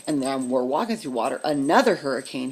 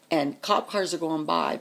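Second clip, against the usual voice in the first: plain reading voice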